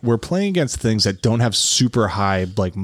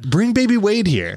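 calling out; 9 semitones higher than usual. Real, not acted. Calling out to absent friend.

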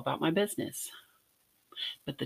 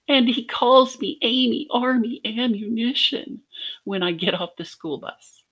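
imitating sobbing child; 7 semitones higher, 4 dB louder than usual. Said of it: "Through sobbing gasps, I choked out".

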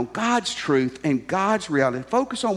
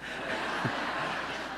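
breathy laugh; 12 dB quieter than usual. Real, not acted. Laughing at own punchline.

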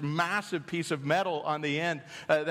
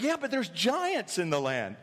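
whiny retort; 7 semitones higher than usual. Retold or imitated.

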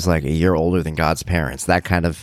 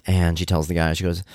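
ordinary talk

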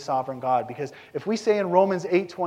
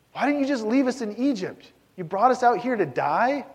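higher, whiny grumbling complaint; mock bitter complaint; Israelites complaining; 6 semitones higher than usual. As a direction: whine it like an accusing crowd. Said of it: "and they say".

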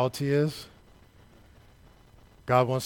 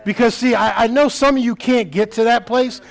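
impassioned preaching, building from raised voice to high shouting; full-force preaching; 9 semitones higher, 9 dB louder than usual. Real, not acted. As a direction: preach it louder and higher, driving every phrase to a shout.

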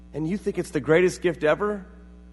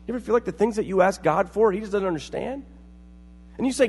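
usual speaking voice

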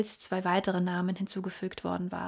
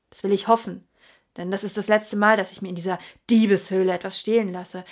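loud, rising rant, faster at the end; furious; 7 dB louder, faster than usual. A narrator's reading. Sir Henry shouting at the hotel waiter, furious. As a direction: demand answers, shouting. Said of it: "Er war dermaßen wütend".

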